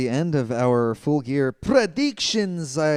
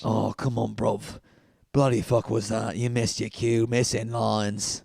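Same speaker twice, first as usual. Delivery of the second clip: put-on British accent; 4 semitones lower, 4 dB quieter than usual. British bloke impression scolding.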